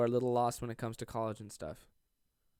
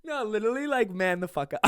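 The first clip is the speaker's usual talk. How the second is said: high giggly laughter through the words; laughing while joking; 10 semitones higher, 6 dB louder than usual. Real, not acted. Laughing at his own mock macho advice. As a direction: laugh through the fake tough talk. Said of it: "I'm fucking kidding".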